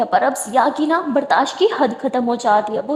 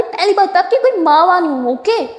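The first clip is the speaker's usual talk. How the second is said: higher and louder snippy comeback; sharp retort; 6 semitones higher, 4 dB louder than usual. Acted. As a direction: put him in his place. Sara snapping back.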